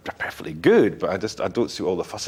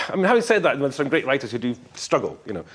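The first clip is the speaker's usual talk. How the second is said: ordinary talk, nothing marked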